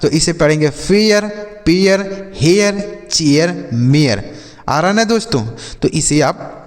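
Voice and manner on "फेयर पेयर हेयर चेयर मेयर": फेयर, पेयर, हेयर, चेयर, मेयर each ending in a two-part "ay-yur"; prononciation « e-yar ». Shown as a demonstration. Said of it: "AI का उच्चारण बदल गया".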